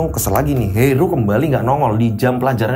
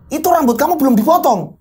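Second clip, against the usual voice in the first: scolding teacher; 10 semitones higher than usual. Acted. Teacher scolds Heru over his uncut hair, higher and sharp. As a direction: snap at the student about his hair.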